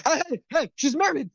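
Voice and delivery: high-pitched indignant objection; protesting onlooker, pitched high. Acted. Onlooker scolds a flirting man.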